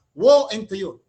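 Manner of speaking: booming warning cry; pronouncing doom loudly; loud proclamation. Retold or imitated. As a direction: thunder out the woe. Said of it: "sabi niya".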